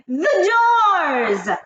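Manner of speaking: a high, loud, booming witch's cry; Grand High Witch shouting. A narrator's reading. Grand High Witch orders her witches to bar the doors, high booming shout. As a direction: fill the whole room with a harsh witch's shout. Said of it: "shouted the Grand High Witch in a voice that filled the room".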